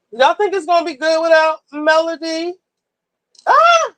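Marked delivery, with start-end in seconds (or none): 3.5-3.9 high loud squeal; excited squeal. Real, not acted. Reacting as the new episode starts, squealing. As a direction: let out a sudden happy shriek.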